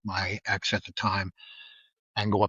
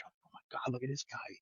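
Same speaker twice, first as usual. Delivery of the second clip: whispered, much softer awe; imitating whispering students; 13 dB quieter than usual. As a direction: hush it like gawking students. Star-struck students whisper together.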